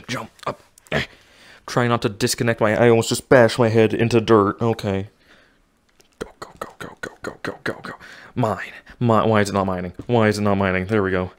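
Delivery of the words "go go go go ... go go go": whispering. Real, not acted. Whispered urging while mining.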